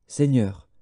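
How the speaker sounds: normal talking voice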